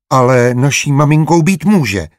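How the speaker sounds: put-on a boy standing his ground; stubborn child. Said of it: "stál si na svém Ignác". (umíněný dětský hlas, výš a hlasitěji)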